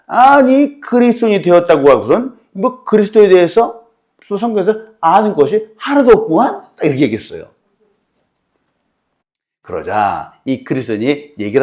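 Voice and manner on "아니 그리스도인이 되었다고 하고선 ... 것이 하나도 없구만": high, loud scornful taunt; sarcastic jeer. Retold or imitated. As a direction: rub it in with contempt. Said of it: "비꼬면서".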